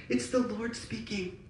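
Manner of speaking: ordinary talk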